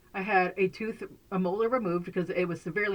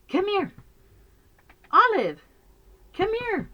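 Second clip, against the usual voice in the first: high, louder coaxing call; calling the dog over; 13 semitones higher, 6 dB louder than usual. Real, not acted.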